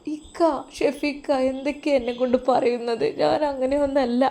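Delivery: on the edge of tears, begging him to believe her; tearful pleading. Acted. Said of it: "അവൾ കരയാൻ തുടങ്ങി".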